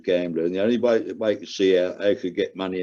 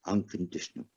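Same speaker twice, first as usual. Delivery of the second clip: soft echo; 3 semitones lower, 10 dB quieter than usual. Real, not acted. Retelling his daughter's letter, he repeats the word in a hushed voice. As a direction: drop to a hush on the repeated word.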